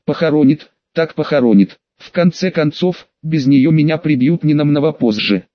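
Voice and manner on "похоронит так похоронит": usual speaking voice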